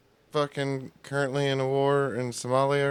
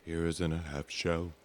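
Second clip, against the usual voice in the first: low announcer voice; 7 semitones lower, 7 dB quieter than usual. Retold or imitated. Low put-on theme voice.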